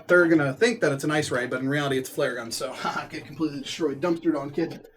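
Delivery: normal talking voice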